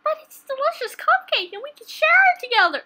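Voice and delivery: high, loud pleading voice; pleading offer. Acted. Pleading to share a cupcake.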